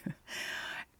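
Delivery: a quiet giggle under the breath; soft breathy laugh. Real, not acted. Quiet laugh after her own excited whisper. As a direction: let a hushed laugh slip out.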